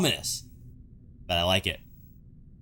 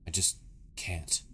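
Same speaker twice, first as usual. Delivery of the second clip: halting, low, fading almost to a whisper; hushed, regretful game character; sorrowful apology; 3 semitones lower, 5 dB quieter than usual. Acted. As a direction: act a reluctant confession, softer and slower at the end. Voices Luigi's halting apology.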